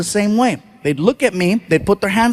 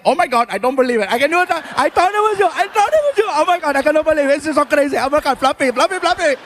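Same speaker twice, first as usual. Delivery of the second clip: much higher, fast gushing fan excitement; excited clerk; 5 semitones higher, faster than usual. Retold or imitated. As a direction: squeal like meeting a star. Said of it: "the guy was like".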